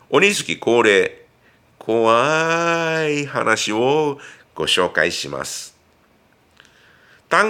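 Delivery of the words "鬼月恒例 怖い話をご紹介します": slow, drawn-out spooky telling; hushed creepy ghost story. Acted. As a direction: lean in and spook the listener. (ゆっくり不気味な怪談口調)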